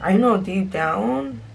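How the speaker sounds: ordinary delivery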